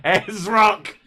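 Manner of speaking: high, loud mock Australian yelp; jokey Australian shout. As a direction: blurt it out like a proud Aussie. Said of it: "They'll be like".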